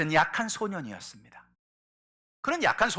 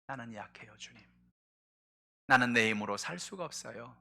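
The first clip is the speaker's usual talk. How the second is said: soft, humble plea to God; a soft, lowered confession; humble prayer; 6 semitones lower, 7 dB quieter than usual. Acted. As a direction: confess weakness quietly. Humble prayer voiced quietly.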